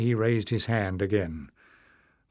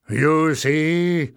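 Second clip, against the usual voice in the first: loud, higher exclamation; crying out; 5 semitones higher, 6 dB louder than usual. A narrator's reading. Leader turning to the crowd, loud cry. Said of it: "Warren cried".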